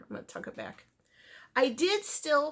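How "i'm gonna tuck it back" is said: soft unvoiced whisper to yourself; whispered self-talk; whispered aside. Real, not acted. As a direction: murmur it to yourself while busy with your hands. Aside while fixing a loose end.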